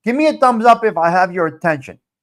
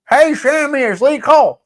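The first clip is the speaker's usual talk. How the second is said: called-out greeting; 7 semitones higher, 5 dB louder than usual. Real, not acted. Calling out a greeting.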